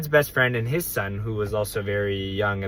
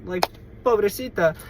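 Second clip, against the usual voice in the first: put-on old lady voice; 9 semitones higher than usual. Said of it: "she was like".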